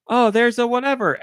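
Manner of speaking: high-pitched mock exclamation; imitating boat guy. Guy in the boat spotting the creature, mock-excited.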